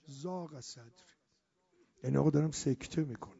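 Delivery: normal talking voice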